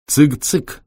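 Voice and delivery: high cheeky rising reply. Acted. Витёк говорит загадку. (лукаво, голосом цыгана)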